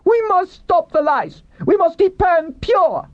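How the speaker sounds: shrieky high, slow zealot's cry; woman's cry. A narrator's reading. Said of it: "cried a woman".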